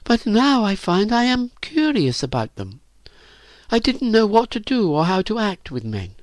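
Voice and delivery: put-on high, girlish, downcast admission; shy confession. A narrator's reading. Virginia confesses with her head down, shy.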